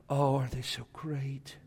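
put-on soft cooing, a touch of baby talk. People holding a newborn baby, soft mock cooing. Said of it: "They go".

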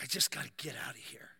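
urgent whisper to himself; panicked whisper. Retold or imitated. Overwhelmed man in a hot room, panicked whisper. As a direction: whisper it like you're trapped. Claustrophobic panic in retold sauna.

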